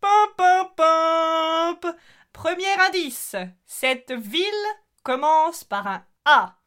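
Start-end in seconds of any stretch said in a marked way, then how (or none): 0.0-2.0 vocal jingle. Acted. Vocal jingle for wrong answer.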